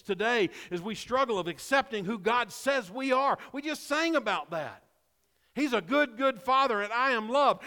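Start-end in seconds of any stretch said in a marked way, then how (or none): 5.5-7.6 preaching. Acted. Preacher builds to full force.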